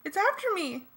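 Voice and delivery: high, frightened squeal; high-pitched panic; panicked scream. A narrator's reading. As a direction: cry out high in terror. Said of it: "she screamed".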